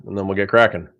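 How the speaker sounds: ordinary delivery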